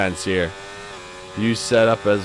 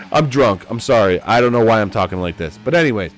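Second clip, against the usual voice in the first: normal talking voice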